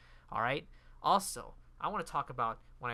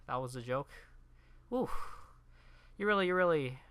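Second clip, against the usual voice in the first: ordinary delivery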